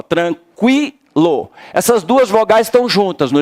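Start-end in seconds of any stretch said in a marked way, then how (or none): 0.0-1.5 tranquilo stretched slowly; teacher dividing the word; slow syllable split. Shown as a demonstration. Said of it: "Divide assim".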